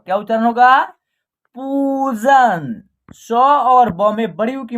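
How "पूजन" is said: पूजन with पू stretched very long; long vowel. Shown as a demonstration. Teacher modelling the word for learners, पूजन drawn out. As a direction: slowly hold the ऊ in पूजन. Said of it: "आप long तरीके से उच्चारण".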